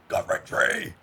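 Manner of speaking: hammed-up raspy title drop; stupid voice. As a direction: silly rasp on the game title. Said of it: "I'm doing a stupid voice".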